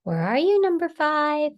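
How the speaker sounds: loud, high call from the doorstep. A narrator's reading. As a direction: call for a missing duckling. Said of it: "on her doorstep calling".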